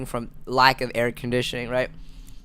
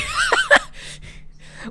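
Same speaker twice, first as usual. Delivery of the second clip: shrieking laugh. Real, not acted. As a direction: crack up with a squeal. Bursts out at being called insane.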